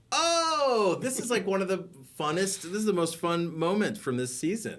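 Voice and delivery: big high burst on oh, then eager; thrilled, rising fan enthusiasm; excited gush. Real, not acted. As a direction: gush about your favourite moment. Gushing about favourite scene.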